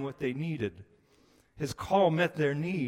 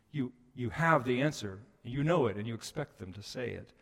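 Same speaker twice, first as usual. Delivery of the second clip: ordinary delivery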